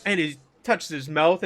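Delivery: ordinary delivery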